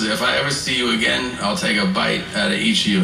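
low, quick mock threat; lightly voiced convict scaring kids; menacing prisoner.

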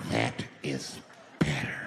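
very low, drawn-out "That is ... better"; low approval. Retold or imitated. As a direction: drop deep and pause before "better". Same words again, dropped low.

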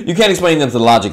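ordinary delivery

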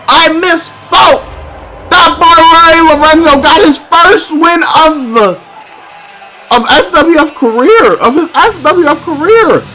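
loud, slow victory call; hyped announcement. Real, not acted. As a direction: announce the win big.

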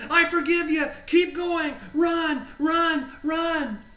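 high, loud shouted urging; cheering on. Retold or imitated. Jesus shouts forgiveness to the runner, cheering on. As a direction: drive the runner on with punchy shouts.

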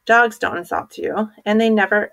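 usual speaking voice

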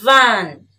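Van, said loud and clear; teacher's model Van, loud; model word. Shown as a demonstration. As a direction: say Van crisply for copying. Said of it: "Now listen and repeat".

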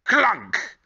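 a loud, high clunk of a door handle; clunk sound. Acted. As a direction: pop the clunk like a sound effect.

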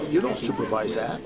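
normal talking voice

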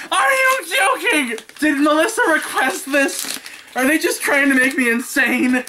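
high-pitched, mock-outraged ranting; hammed-up exasperation. Ranting at Amazon over nested boxes, hammed-up exasperation. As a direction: rant in theatrical disbelief, pitch way up.

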